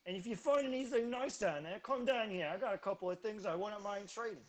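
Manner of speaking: put-on brash New Jersey accent, pushed loud and high. Half ogre in a New Jersey accent giving directions to travellers. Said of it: "he suddenly became New Jersey".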